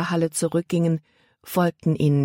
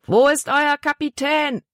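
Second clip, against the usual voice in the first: mild bellow, pitched high; 10 semitones higher than usual. A narrator's reading. Big man to the boy on board, bellowing. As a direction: demand it in a high, raised voice. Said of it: "brüllte der große Mann".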